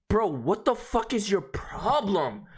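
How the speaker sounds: high, drawn-out incredulous demand; exasperated. Retold or imitated. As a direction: stretch it out in exasperation. Exasperated reply to screaming caller.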